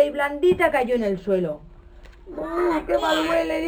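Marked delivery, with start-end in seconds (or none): 2.3-3.6 mole crying out at the stink; disgusted cry. Acted. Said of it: "dijo el topo".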